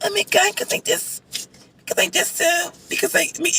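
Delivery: put-on tiny, high, whispery baby talk; mock baby voice. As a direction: squeak it softly like a put-on baby. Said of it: "that baby voice that she does".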